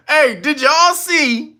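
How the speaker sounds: ordinary talk, nothing marked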